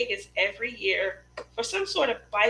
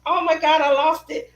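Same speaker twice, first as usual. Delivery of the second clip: retold dismay; 7 semitones higher, 8 dB louder than usual. Retold reaction to losing the accent.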